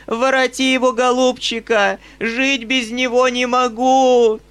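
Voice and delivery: high, loud pleading wail; a shrill old mother begging loudly; old woman wailing. A narrator's reading. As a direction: wail it at her son like a desperate old woman. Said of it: "продолжала вопить старуха".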